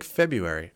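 "February" as FEB-yoo-ary; soft, easy "February" with no first R; cleaner pronunciation. Shown as a demonstration. Showing the cleaner version, "February" as FEB-yoo-ary. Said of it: "It sounds so much cleaner".

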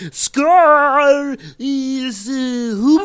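squeaky high goofy voice; high silly voice. Pretend. Silly sports bit, high voice.

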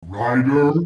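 heavy, loud creature attempt at speech; creature repeating a name. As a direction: echo the name like a big beast. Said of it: "And it goes".

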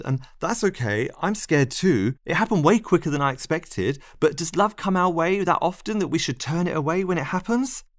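high, urgent, rushing toward the end; desperate pleading. Acted. Narrator begs a lover not to walk away, pleading.

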